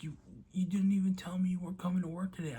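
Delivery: imitating an indignant dad, high, loud and fast; agitated protest. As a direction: blurt out the accusation, mock-flustered. Acting out dad's agitated complaint.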